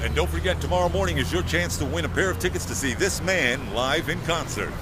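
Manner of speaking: polished station promo voice; great radio voice. Shown as a demonstration. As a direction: sell the ticket giveaway like a radio announcer. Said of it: "Say something with that great radio voice".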